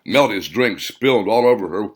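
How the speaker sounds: ordinary delivery, nothing marked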